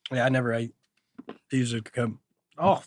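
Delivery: ordinary delivery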